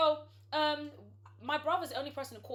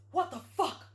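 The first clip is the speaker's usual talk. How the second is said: raised, stunned exclamation on a loop; stunned repeated line; 4 semitones higher, 5 dB louder than usual. Retold or imitated. Imitating a man caught on the bed, stunned repeat. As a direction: repeat it identically, imitating his shock. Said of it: "in the same tone".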